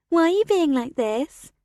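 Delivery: mocking sing-song, rising end. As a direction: sing it back to mock her. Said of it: "Olivia repeats in a sing-song voice".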